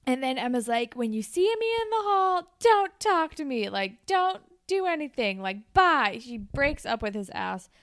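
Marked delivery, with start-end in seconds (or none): 0.9-6.2 high, loud, final brush-off; louder, higher breakup kiss-off; dumping him. Acted. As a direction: cut him off for good. Emma breaking up with Peter.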